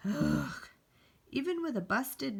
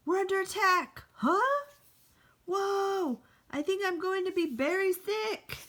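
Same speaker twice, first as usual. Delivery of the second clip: higher, louder alarmed cries while being jostled; alarmed yelling; 7 semitones higher, 5 dB louder than usual. Acted. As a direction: yell it while bouncing on a bear. Riding bear, bouncing, alarmed.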